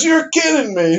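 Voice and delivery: high, stunned "you're kidding me"; incredulous dad. Retold or imitated. Dad reacts to the prank.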